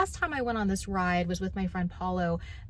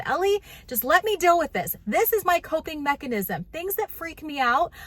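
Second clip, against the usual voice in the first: emphatic insistence; 7 semitones higher than usual. Retold or imitated. Mom insists to daughter on ride.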